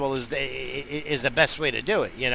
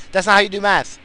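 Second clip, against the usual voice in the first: loud insistence; 7 semitones higher, 8 dB louder than usual. Real, not acted. Ranting about math.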